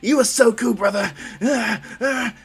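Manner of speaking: high, loud, adoring outburst; gushing excitement. Acted. Dark Shadow hugging Midoriya, gushing excitedly. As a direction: squeal it like an overjoyed hugger.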